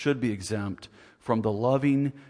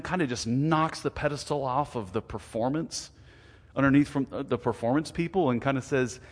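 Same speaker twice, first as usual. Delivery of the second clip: usual speaking voice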